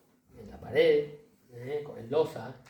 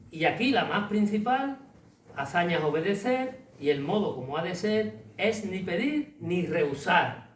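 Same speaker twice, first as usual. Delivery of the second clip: much higher and louder recitation; declaimed verse; 3 semitones higher, 3 dB louder than usual. Acted. Lecturer reciting the suspect verses aloud, grandly declaimed. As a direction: proclaim the couplet like a stage actor.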